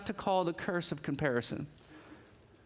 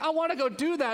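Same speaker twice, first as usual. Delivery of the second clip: eager wife; 9 semitones higher, 3 dB louder than usual. Retold or imitated. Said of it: "And she's like".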